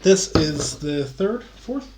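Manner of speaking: tipsy, fumbling over numbers. Real, not acted. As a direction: slur a little, lose count. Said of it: "I'm very drunk right now".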